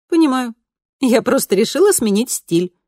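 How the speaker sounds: lightly voiced cheerful teen girl; bright daughter voice. A narrator's reading.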